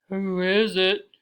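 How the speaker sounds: loud, slow, deep boom; big deep voice, drawn out; deep intercom voice. A narrator's reading. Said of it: "asked a deep voice on the intercom".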